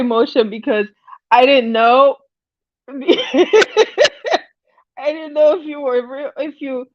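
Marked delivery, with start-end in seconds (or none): 2.9-4.4 a rising giggle; laughter. Real, not acted. Host giggles after apologizing to the viewer, rising laughter.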